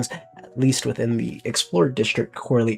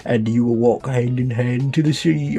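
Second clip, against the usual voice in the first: speechify like a British leader on tape; British accent. Acted. Leader welcoming citizens to Un London, in a light British accent. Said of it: "I have to do the accent".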